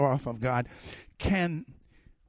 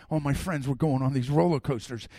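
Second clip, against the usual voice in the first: ordinary talk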